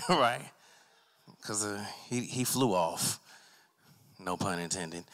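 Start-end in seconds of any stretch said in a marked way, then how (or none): none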